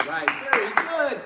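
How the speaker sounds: excited praise. Real, not acted. Praising the kids.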